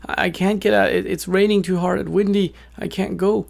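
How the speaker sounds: high, stammering little bird voice. A narrator's reading. Little bird telling the wolf it can't leave, high and flustered. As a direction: plead in a small, high-pitched voice. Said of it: "And the bird says".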